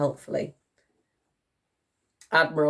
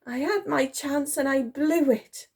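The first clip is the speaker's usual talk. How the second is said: high self-pitying lament; despairing wail; 9 semitones higher than usual. A narrator's reading. Soldier giving up hope, despairing wail. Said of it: "Titch wailed in despair".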